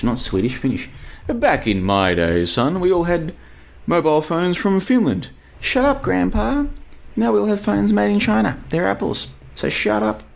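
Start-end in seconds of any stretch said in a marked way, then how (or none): 1.3-5.3 put-on high old grandpa voice; old man lecturing about the old days; old boomer voice. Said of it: "I've gone into boomer mode". 5.6-10.2 put-on bratty kid voice. Kid answering the grandpa.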